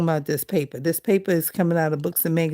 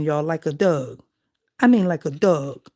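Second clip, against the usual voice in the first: ordinary delivery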